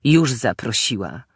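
dry mocking retort. A narrator's reading. Husband answering his wife, mocking tone. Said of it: "odparł kpiącym tonem".

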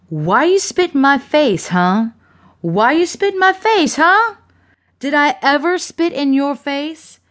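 high, indignant interrogation; enraged demanding. A narrator's reading. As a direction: demand an answer, pitch climbing with outrage. Woman rages with rock raised.

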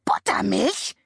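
Buttermilch? shrieked high in disbelief; shrieking raven. A narrator's reading. Raven shrieks at the buttermilk rain, high and shocked. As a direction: screech the word Buttermilch? up high. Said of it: "kreischte Abraxas".

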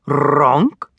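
small honking creature with a questioning rise; dragonling's cry. A narrator's reading. Baby dragon answering the boy, questioning honk. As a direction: honk the word, tipping it up at the end. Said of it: "said the dragon".

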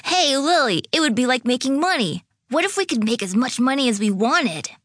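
put-on high-pitched, eager kid voice; excited young boy. Dante tells Lily about making money, excited. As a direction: pitch a wild idea like a thrilled child.

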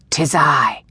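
unvoiced whisper from the dark; deep male whisper. A narrator's reading. Unseen man answers her from dark corners, whispered. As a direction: whisper it low, as a man hidden in shadow. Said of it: "whispered from the dark corners".

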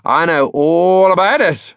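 high, loud, drawn-out boast; enthusiastic, slow and emphatic; energized. A narrator's reading. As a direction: stretch it out with eager energy. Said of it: "energized again".

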